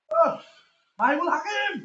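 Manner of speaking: high loud exclamation; excited shout. Real, not acted. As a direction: call the name in excitement. Exclaiming about teammate's play.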